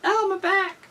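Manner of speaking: high-pitched pretend ouch; mock back pain. Reacting to a wrestler's hurt back, mock pained.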